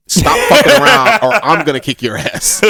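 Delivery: high, loud opening that settles down; hammed-up scolding; mock threat. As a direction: act out a fed-up warning. Acting out a threat to Vegeta.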